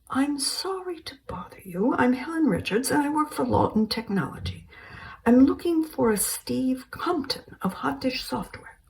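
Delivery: high, light, polite English lady; a prim British woman on the phone; British caller. Acted. Voices British caller Helen.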